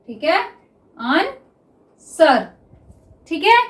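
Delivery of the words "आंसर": Officer drawn out very slowly; teacher dictating officer to students; slow dictation. Shown as a demonstration. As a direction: stretch officer as if writing it down. Dictating while writing shorthand.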